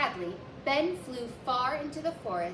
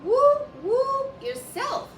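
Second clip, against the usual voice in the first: high, loud owl hooting back; a bright, high owl mocking the ghost; high clear owl voice; 13 semitones higher, 11 dB louder, slower than usual. A narrator's reading. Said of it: "said a high, clear voice".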